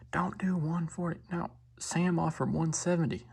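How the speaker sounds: high, slow imitation of a country woman; mock yelling lady. Lady telling the dude to refuse, mock yelling. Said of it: "the lady's yelling at the dude".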